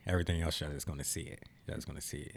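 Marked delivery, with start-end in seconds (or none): 1.7-2.4 breathy whisper of "see it", much softer. Shown as a demonstration. Host teasing listeners with a secret, hushed whisper. Teasing closing line repeated.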